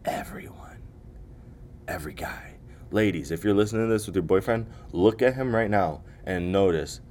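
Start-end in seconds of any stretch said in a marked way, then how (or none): 0.0-2.6 whispered confession. Real, not acted. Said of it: "whispered reveal".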